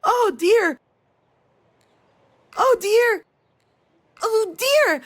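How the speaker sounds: put-on high, loud toddler cries, repeated; imitating a child screaming. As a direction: squeal it like a startled little girl.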